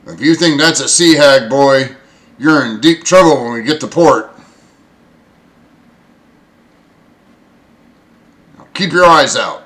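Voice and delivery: loud, scolding warning; stern bosun. Acted. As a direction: bawl out a young crewman. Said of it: "he looks over at you and goes".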